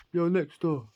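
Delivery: high call through the prison wall; calling next door. Retold or imitated. Neighbour calls through the wall, high call.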